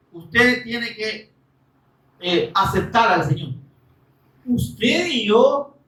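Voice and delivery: normal talking voice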